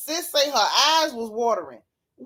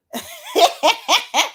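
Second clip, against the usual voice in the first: a sudden loud laugh; loud high laugh; 12 semitones higher, 5 dB louder than usual. Real, not acted. Laughs at yawning viewers.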